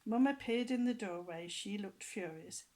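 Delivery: usual speaking voice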